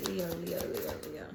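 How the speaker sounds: hushed whisper to herself. Acted. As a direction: breathe the name softly. Murmuring while pulling cards.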